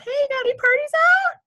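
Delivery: a hint of end-of-month panic, high and pleading; imitating her past self begging teammates for parties; panicky message. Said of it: "I remember messaging my teammates".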